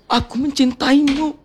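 dramatic heartbroken lament; acted tearful breakup plea; fake crying. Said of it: "Coba acting nangis dulu". (tangisan patah hati yang didramatisir)

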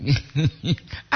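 soft giggle; quiet bubbling chuckle; laughter. Real, not acted. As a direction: giggle lightly. Amused by own intonation.

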